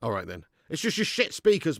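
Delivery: normal talking voice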